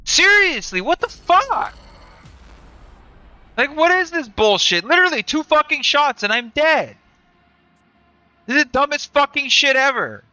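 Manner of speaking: angry outburst. Real, not acted. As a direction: blow up at the game, real rage.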